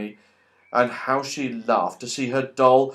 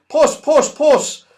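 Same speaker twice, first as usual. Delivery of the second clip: high, loud calling out; calling a cat; 10 semitones higher, 8 dB louder, slower than usual. Acted. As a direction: call the cat from under the tables. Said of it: "to hear him calling".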